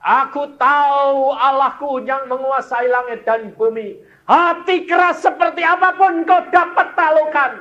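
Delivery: high, loud fervent prayer of a son; son's tearful prayer, preached. Retold or imitated. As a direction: cry out the son's thanks to God. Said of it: "dia mengatakan".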